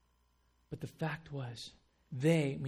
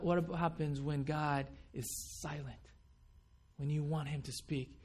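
ordinary talk, nothing marked

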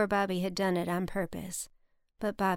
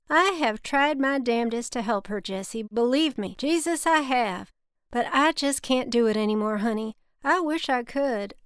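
emphatic, louder Southern drawl; Bobby, thick Southern drawl; 5 semitones higher, 5 dB louder than usual. A narrator's reading. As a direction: plead your case in a thick cotton drawl. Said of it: "in his thick cotton drawl".

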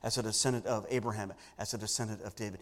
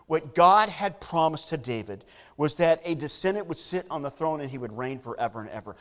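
normal talking voice

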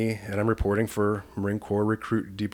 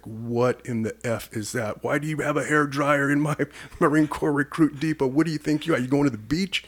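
higher, imitating outraged drill sergeants; retold drill instructors mocking a recruit; mock drill instructor rant; 6 semitones higher, 3 dB louder than usual. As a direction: ridicule the recruit's hair dryer, hammed-up. Drill instructors going ballistic.

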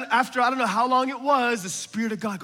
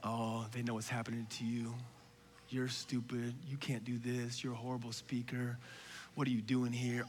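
softer, lower voice of self-doubt; inner critic; 11 semitones lower, 17 dB quieter than usual. Retold or imitated. Preacher recalls his inner critic jeering, softly. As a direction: murmur the mocking inner critic. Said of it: "what's coming into my head".

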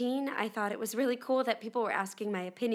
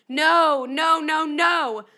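high, loud screaming no no no; screaming toddlers; 6 semitones higher, 11 dB louder, much slower than usual. Retold or imitated. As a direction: scream like a toddler at the top of their lungs. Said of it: "at the top of their lungs".

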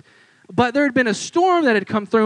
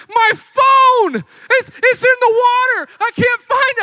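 much higher, louder mock tearful girl; a teenager crying over a lost phone, much higher mock wail; crying teenage girl; 7 dB louder than usual. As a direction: wail it like a teen who lost her phone. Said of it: "Tears, panic, anxiety".

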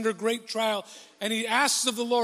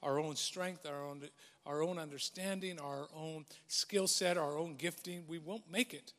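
quiet, reflective preaching, softer than the rest; soft sermon; 7 semitones lower, 12 dB quieter than usual. Acted. Pastor gently reflecting to his church, soft preaching voice. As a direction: ease in gently, as if confiding to the congregation.

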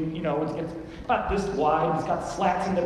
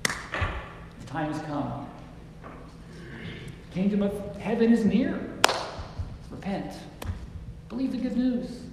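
kindly. Shown as a demonstration. Preacher showing how Jesus spoke to people, kindly. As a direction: offer it kindly, with long pauses. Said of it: "I think he said it kindly".

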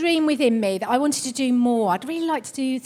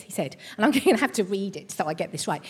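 ordinary talk

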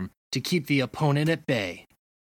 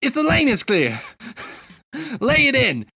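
higher, louder, breaking into laughs; laughing coach; 10 semitones higher, 3 dB louder than usual. Acted. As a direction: lose the coach voice to giggles. Coach finishing the play, laughing.

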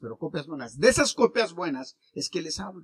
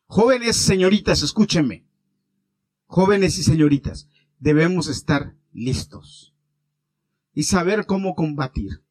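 put-on loud, urgent call to the young people; preacher rallying the youth, loud and urgent; fiery preaching; 6 dB louder than usual. Preaching at full force to youth.

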